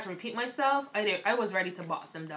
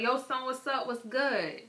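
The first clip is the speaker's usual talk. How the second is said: mock slang greeting; 6 semitones higher than usual. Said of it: "they come back like".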